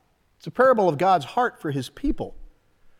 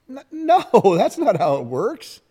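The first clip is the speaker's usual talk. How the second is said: laughing no; 6 semitones higher, 4 dB louder than usual. Real, not acted. Laughing rebuttal to voiced claim.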